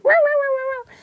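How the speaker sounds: very high, loud dog yelps; imitated excited yelps. Dog greeting mum and dad, imitated as high excited yelps. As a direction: yap like an overjoyed little dog. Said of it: "started screaming and bouncing about".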